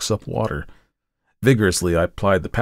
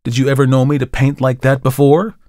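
louder, indignant question; annoyed painter; 5 semitones higher, 7 dB louder than usual. A narrator's reading. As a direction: challenge her, irritated.

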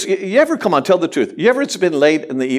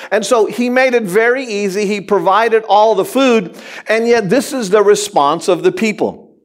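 normal talking voice

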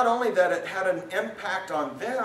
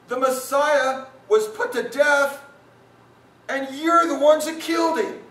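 accusing proclamation; 10 semitones higher, 7 dB louder than usual. Retold or imitated. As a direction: hammer it home like a stern prophet. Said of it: "I remember one thing he said".

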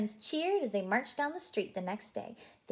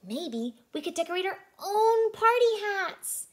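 high, bright puppy voice; Blue's voice; 11 semitones higher, 8 dB louder than usual. A narrator's reading. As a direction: voice Blue high and eager. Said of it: "Blue said".

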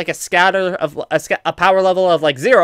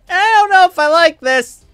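voicing the game; 12 semitones higher, 4 dB louder than usual. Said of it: "my Xenoverse is just kind of like".

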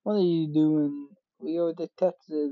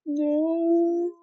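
shrill creature wail; 13 semitones higher, much slower than usual. Acted. A tiny creature wailing over its chocolate, high and slow. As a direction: cry out for the chocolate in a squeaky creature voice.

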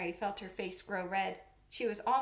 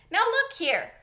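sharp, much higher and louder; sharp angry; 10 semitones higher, 11 dB louder, slower than usual. Acted. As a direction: snap it out angrily. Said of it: "he said sharply".

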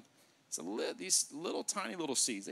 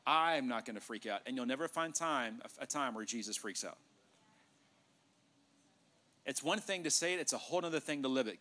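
usual speaking voice

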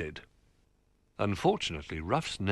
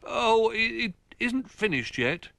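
high, stammering small bear; flustered Little Bear; 4 dB louder than usual. Acted. Little Bear stalling Old Bear over the picture, flustered. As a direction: stall nervously, dragging it out.

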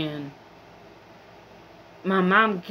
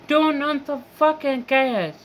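higher-pitched frustrated demand with TURN hit hard; exasperated yell; 7 semitones higher than usual. Real, not acted. Venting at the gas company, exasperated high yell. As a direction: snap it out like a fed-up outburst.

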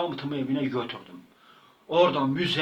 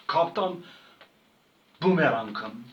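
ordinary talk, nothing marked

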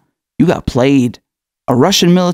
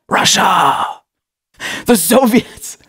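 mock red scare panic; 5 semitones higher, much slower than usual.

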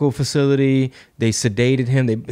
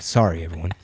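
usual speaking voice

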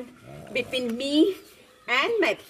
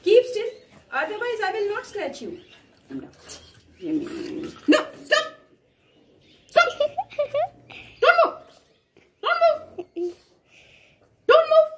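scolding; 11 semitones higher than usual. Real, not acted. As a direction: bark orders at the dog to stay still. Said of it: "when I'm scolding him".

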